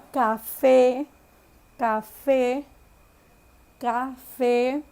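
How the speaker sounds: café with an open, unchanged é, said slowly; Spanish café. Shown as a demonstration. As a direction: pronounce café clearly as in Spanish, é kept pure. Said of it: "Pra vocês verem que não muda a pronúncia".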